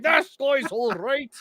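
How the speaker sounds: high, drawn-out approving voice; hammed-up local approval; mock Irish local. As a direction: stretch it out in a put-on accent. Voices approving locals after the song.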